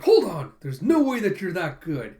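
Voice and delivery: loud acted-out protest; indignant objection. Losing player challenges the winner, indignant. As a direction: cut in loudly, refusing to believe it. Retold loser objects loudly.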